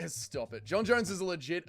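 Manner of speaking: ordinary talk, nothing marked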